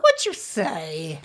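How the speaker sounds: low, slow put-on voice; slow low put-on accent; mock accent. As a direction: drawl it back teasingly. Teasing echo in accent.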